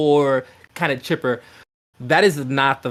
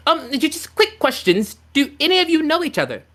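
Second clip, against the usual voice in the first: lilting fairy queen; 12 semitones higher than usual. Acted.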